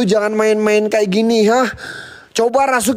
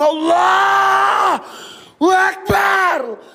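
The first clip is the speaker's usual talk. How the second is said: terrified shouted prayer; 7 semitones higher, slower than usual. Real, not acted. Ghost hunter prays against the spirits, crying out in fear. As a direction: cry out Allahu Akbar high and drawn out, near tears.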